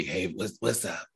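raspy hungover morning voice. Retold or imitated. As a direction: rasp it out, half awake. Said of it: "It's, like, real raspy".

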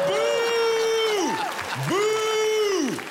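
boo from the crowd. Acted. Heckler booing the host.

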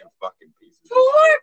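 high, hammed-up wailing insult; mock crying rant. Joking at the hosts in a hammed-up wailing rant. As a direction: wail it like a fake tantrum.

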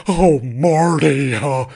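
slow, drawn-out Broadway theatrical Linney; theatrical Linney, dragging out Marty; Laura Linney impression. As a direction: ham up a dramatic stage actress. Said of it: "my Laura Linney".

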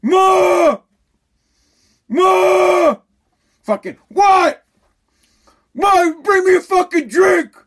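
loud yell across the house. Real, not acted. Yelling at his mother from the couch, loud and demanding. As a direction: shout for your mother to bring a drink.

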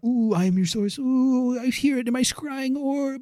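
high, wavering fortune-teller croon; mystic seer. Pretend. Playing a seer with a scrying orb for his cohost, high mystic croon. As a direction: ooh it out like a mystic gazing into an orb.

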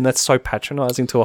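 ordinary delivery

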